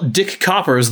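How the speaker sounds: normal talking voice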